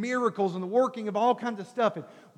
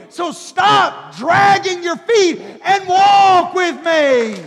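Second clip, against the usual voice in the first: loud, slow, higher preached cry; climactic shout; 7 semitones higher, 10 dB louder, much slower than usual. Real, not acted. Preacher urging the church to walk with God, shouting. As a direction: drive it home, slow and loud.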